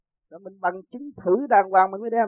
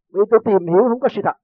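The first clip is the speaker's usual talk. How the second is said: quick, higher and louder retold protest; angry retort; 4 semitones higher, 7 dB louder, faster than usual. Friend irritably rejecting religion to the narrator, angry retort. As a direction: snap back in mild indignation. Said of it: "Nó tức".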